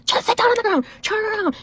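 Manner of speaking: scolding screech held back in volume; imitated yelling. Lady ordering little kid to sit down, mock yell. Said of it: "lady that was yelling at the little kids".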